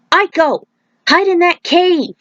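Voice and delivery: high, urgent call to her across the jungle; hollered warning. Acted. Monk hollers to Aiko to hide in the cave, high and urgent. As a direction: yell it out so she hears you. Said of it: "the monk hollered".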